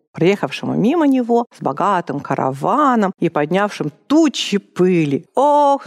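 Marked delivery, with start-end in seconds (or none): 5.3-5.9 high, drawn-out "oh" of longing; a storyteller's imploring moan; pleading sigh. A narrator's reading. Stonecutter envying the rich merchant, pleading. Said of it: "взмолился каменотёс".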